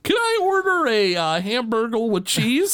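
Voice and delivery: high-pitched customer voice; mock customer ordering. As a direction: put on a high, polite ordering voice.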